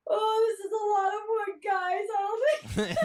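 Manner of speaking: high, whimpery fake crying; hammed-up tearful wail about the woods; mock crying Kaylee. Co-host imitating Kaylee entering the forest, mock crying. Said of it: "She's like".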